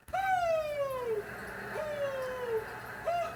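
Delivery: high mock owl hoots; an old drunk hooting across the lake; old man hooting. Neighbor on his dock hooting, high owl hoots.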